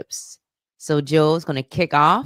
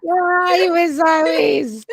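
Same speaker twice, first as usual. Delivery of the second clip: high laughing teasing; 12 semitones higher, 4 dB louder than usual. Real, not acted. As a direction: say it while cracking up. Laughing at co-host's slip.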